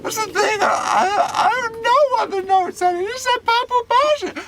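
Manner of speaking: very high, loud mock sobbing; hammed-up crying squeal; tearful wail. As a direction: bawl the explanation like a distraught child. Crying boy explains to police.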